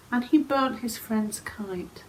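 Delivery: ordinary delivery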